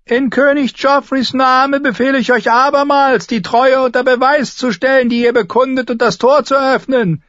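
high, thin, loud command; very high-pitched imperious knight; Sir Amory Lodge. A narrator's reading. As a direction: order them in a piercing, thin voice. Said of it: "Seine Stimme war hoch und dünn".

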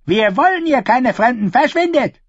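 high, sharp voice of a gruff peasant woman; a suspicious woman shooing strangers off; hostile woman. Acted. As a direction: turn them away coldly from the window. Woman sends strangers away.

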